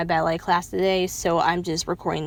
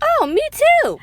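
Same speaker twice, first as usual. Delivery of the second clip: squeaky fake-excited "me too", much higher and louder; mock eager; 7 dB louder than usual. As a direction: play up fake enthusiasm. Eager reply to fellow fan.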